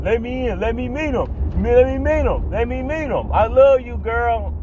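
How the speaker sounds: high, hammed-up pleading ex yelling; mock yell. Jealous ex yelling at the girlfriend, high hammed-up plea. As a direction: beg to be let in, voice way up. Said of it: "he still yelling".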